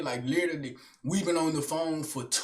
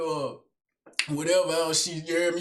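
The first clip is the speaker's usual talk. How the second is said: ordinary delivery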